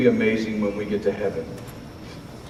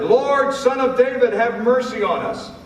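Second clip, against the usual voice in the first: shouted plea; 13 semitones higher than usual. Acted. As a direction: call out over the crowd, pleading. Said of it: "they shouted".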